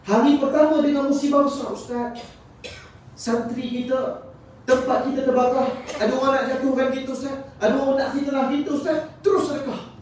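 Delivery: high-pitched, insistent sermon delivery; high, pressing preacher's voice; urgent preaching. Acted. Preaches through acted troubles.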